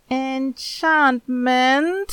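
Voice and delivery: put-on a boy's worried, drawn-out reply; uneasy. A narrator's reading. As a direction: say it nervously, unsure of yourself.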